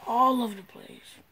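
sudden loud outburst; loud sharp voice. Real, not acted. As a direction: snap it out loud. Loud burst after quiet line.

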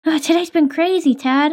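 ordinary talk, nothing marked

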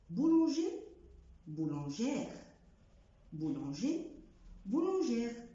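بولانجي without r, بولانجير with r; masculine and feminine. Shown as a demonstration. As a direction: contrast the two endings. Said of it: "لو كنت نطقت ال R". (بولانجي وبولانجير)

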